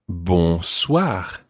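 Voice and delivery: bonsoir with nasal bon and throaty R; bonsoir, French pronunciation. Shown as a demonstration. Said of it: "To say good evening to someone".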